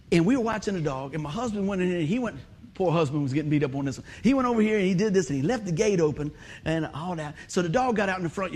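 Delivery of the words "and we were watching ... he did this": ordinary delivery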